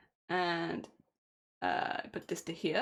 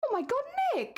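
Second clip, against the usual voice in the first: startled exclamation. Real, not acted. Suddenly startled, she shouts at Nick in surprise.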